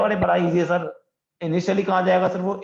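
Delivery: eager student. Pretend. Teacher voices a student answering. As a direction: play the keen student calling out.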